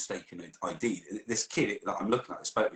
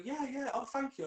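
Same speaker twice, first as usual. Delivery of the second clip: high polite owner. Retold or imitated. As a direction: play the flattered dog owner. Dog owner replying.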